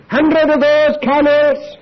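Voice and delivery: hammed-up grand tally; loud mock declaration. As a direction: proclaim it grandly. Abraham counting tithes.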